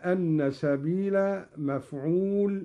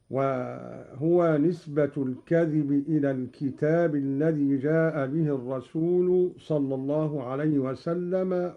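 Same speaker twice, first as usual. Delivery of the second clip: normal talking voice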